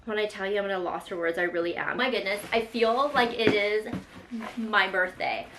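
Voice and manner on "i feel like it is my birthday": ordinary talk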